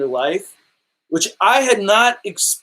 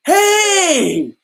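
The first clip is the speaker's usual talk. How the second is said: high, loud friendly shout; playful shout; 13 semitones higher, 5 dB louder than usual. Retold or imitated. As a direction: call out hey like spotting a fan. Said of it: "and he goes".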